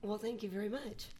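very soft thank-you; off-mic quiet. Real, not acted. Guest thanking her podcast hosts from afar, very quiet. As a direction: say it softly, away from the mic.

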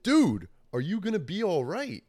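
high, loud, emphatic question; insistent repeat. Retold or imitated. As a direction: press the question on someone dazed. Repeats question after dazed huh.

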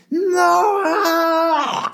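high, drawn-out mock cry; tantrum wail. Nine-year-old turned away from the ride, wailing. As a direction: imitate a child erupting into bawling. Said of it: "went from a smile again to like".

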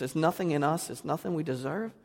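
usual speaking voice

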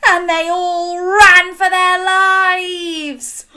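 high, slow dramatic cry; excited. A narrator's reading. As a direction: burst out in alarm. Dramatic climax of story.

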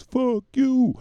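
high, loud drawn yell. Acted. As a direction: belt out the rapper's line. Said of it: "He said".

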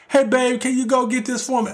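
high sweet mock voice asking babe for a favor; playful high imitation of the girlfriend; mock partner request.

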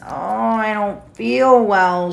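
slow, drawn-out fake-sick moan; groaning little faker. A narrator's reading. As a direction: moan it out slowly, playing poorly. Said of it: "D W made loud moaning sounds".

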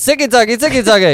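loud, high call-out to the crowd; rally the room into the cheer; party cheer. Acted. Host rallying co-hosts into the Zicki Zacki cheer, loud and high.

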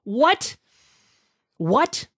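high, rising disbelief; can't believe what he heard; rising incredulous what. Real, not acted. Incredulous reaction.

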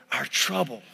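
put-on a low, gruff old preacher growl; deep, low preacher's rumble. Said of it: "He'd get all fired up".

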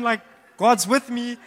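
ordinary talk, nothing marked

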